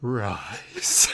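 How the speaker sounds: hushed unvoiced whisper of the oath; spooky whispered command. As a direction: breathe the word like a dark ritual.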